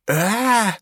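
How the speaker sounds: high, drawn-out shriek; high cry of disgust. A narrator's reading. Max sees the picture, high shocked cry. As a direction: cry out on one long high note. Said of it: "rief Max".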